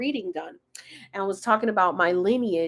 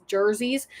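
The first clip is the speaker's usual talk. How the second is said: usual speaking voice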